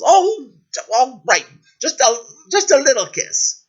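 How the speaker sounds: high, flustered royal voice; squirming, high-pitched consent; reluctant princess. Acted. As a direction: give in grudgingly.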